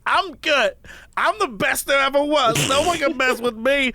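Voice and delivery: a mocking take on a cocky reality star; mock diva boast. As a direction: imitate her self-important boast. Mocking the kicked-out girl.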